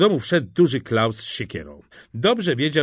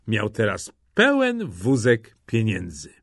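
ordinary delivery, nothing marked

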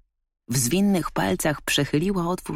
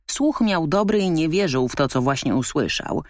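usual speaking voice

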